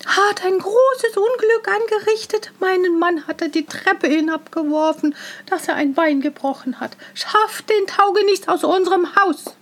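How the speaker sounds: high, agitated outraged woman; shrieked complaint. A narrator's reading. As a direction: cry out the accusation. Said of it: "eilte dann mit lautem Geschrei".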